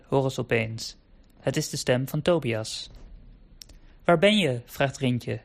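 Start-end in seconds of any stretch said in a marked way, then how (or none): 4.0-4.6 higher, louder shouted question; calling out. Acted. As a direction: call out into the fog to find him. Calling back through fog.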